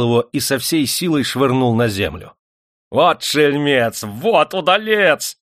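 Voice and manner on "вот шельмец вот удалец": slow, high, drawn-out loud praise; overjoyed. A narrator's reading. Master rejoices at the miner's victory, overjoyed. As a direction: crow with open delight. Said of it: "не скрываясь, обрадовался Прокофий Акинфич".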